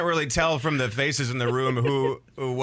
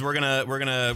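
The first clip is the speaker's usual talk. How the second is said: ordinary talk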